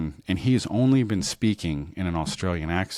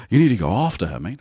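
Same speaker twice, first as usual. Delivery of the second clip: louder, higher mock Aussie urging; imitating a pushy Australian mate; fake Australian accent; 4 semitones higher, 6 dB louder, faster than usual. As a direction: insist in a hammed-up Australian accent. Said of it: "talking to her in the Australian accent".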